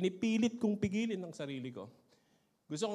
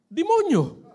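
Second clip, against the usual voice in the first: put-on child's answer; 11 semitones higher, 14 dB louder, slower than usual. Child answers tanod's question.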